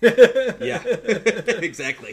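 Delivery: loud, high laughing agreement. Real, not acted. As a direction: burst out laughing while agreeing. Laughs at the joke.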